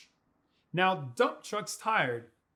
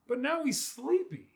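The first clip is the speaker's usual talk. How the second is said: ordinary delivery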